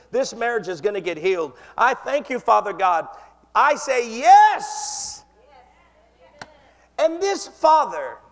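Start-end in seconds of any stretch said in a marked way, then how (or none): 3.5-5.2 louder, slow and drawn-out declaration; emphatic yes. Acted. Preacher declaring faith to God, slow and loud. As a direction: proclaim it like a victory.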